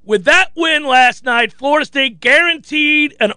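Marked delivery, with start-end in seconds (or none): none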